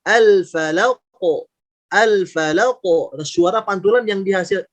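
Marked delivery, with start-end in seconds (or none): 0.0-2.8 "al-falaq" ending in an echoed, heavy "qo"; thick bouncing qaf. Shown as a demonstration. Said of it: "yang dihasilkan pun tebal". ("al-falaq" dengan pantulan qaf tebal, tidak tipis)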